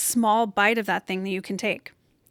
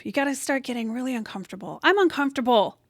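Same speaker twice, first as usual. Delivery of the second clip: usual speaking voice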